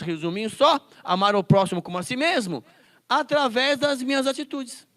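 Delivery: loud, rising sermon, slowing on the key words; fervent pulpit voice; preaching at full force. Acted. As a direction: preach it out to the whole church.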